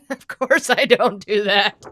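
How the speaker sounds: amused denial, slow and chuckling; laughing. Real, not acted. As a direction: laugh while denying it. Laughs at the joke.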